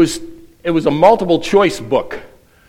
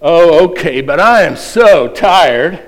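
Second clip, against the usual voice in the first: slow, louder put-upon moan; hammed-up weary moan; 3 semitones higher, 6 dB louder than usual. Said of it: "Do you moan and say".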